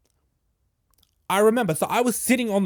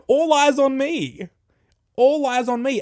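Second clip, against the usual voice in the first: dramatic announcement; 6 semitones higher, 5 dB louder than usual. Retold or imitated. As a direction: announce it like a slogan. Reading shirt slogan dramatically.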